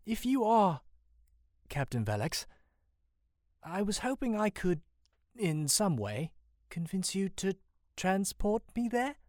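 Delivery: high, tentative pleading; unsure young Sam, voice lifted; hesitant request. A narrator's reading. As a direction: ask haltingly, in broken phrases. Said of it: "Sam hesitantly continues".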